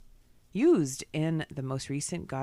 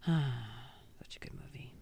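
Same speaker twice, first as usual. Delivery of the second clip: whispering; 2 semitones lower, 9 dB quieter, slower than usual. Real, not acted. Fond whispered aside.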